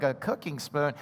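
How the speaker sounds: flat, quiet unimpressed mutter; underwhelmed kid's aside; mock deadpan. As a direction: let the letdown drop quiet and flat. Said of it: "you're like".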